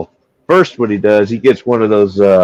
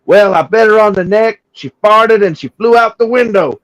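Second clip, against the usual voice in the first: high-pitched punchline voice; prankster buddy; 11 semitones higher than usual. Retold or imitated. Prankster explaining the doll to his friend, high and innocent.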